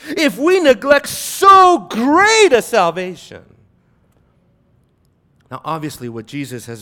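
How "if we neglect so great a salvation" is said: slow, high, loud proclamation; weighty warning. Real, not acted. Preacher driving home a warning to the congregation, weighty shout. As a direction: thunder it out, stretching the key words. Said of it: "Feel the weight of that".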